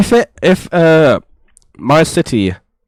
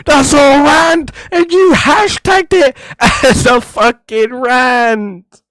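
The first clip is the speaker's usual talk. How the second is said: exasperated yelling; 8 semitones higher, slower than usual. Real, not acted. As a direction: yell it in disbelief, half laughing. Calling out a fake rant.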